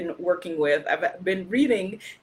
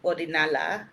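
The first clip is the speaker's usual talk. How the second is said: usual speaking voice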